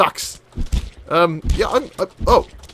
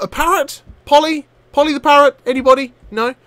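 ordinary delivery

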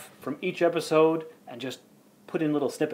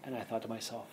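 ordinary talk

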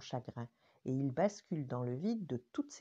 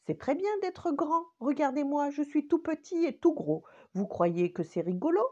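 high, louder playful voice; jolly thumb voice; 10 semitones higher, 6 dB louder than usual. Acted. Monsieur Pouce clowns to cheer up the crying finger.

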